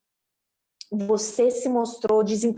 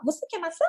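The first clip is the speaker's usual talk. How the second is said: put-on child echoing "Você quer maçã?" higher, as a question; copied question intonation; 7 semitones higher, 3 dB quieter, faster than usual. Child asks parent by echoing the offer, question intonation. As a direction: lift "quer maçã" in a sing-song question. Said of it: "ela fala o mesmo".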